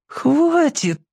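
high pleading cry; plaintive. A narrator's reading. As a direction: beg it plaintively. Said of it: "жалобно попросил Яшка".

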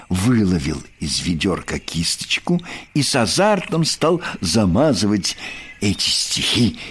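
usual speaking voice

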